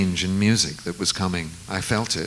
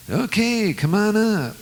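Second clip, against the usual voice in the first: pitched way up, a [name]-style imitation; 13 semitones higher than usual. Tour manager inviting [name] up, imitated in a high [name]-style voice. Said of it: "everybody in [name]'s entourage sounded like him".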